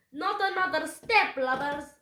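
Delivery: loud, high pirate warning; pistol-waving captain's bellow; shouted threat. A narrator's reading. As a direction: threaten them at full voice. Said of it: "Captain Bones shouted".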